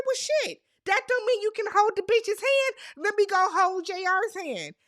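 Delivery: high-pitched, loud sassy comeback; high, heated imitation of a quarrel; indignant mock retort. Acting out Aria's angry retort.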